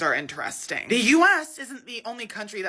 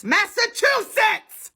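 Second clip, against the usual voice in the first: higher, slow shouted word; frustrated shout; 12 semitones higher, 5 dB louder, slower than usual. Real, not acted. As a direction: shout it out at the filter. Frustrated retry.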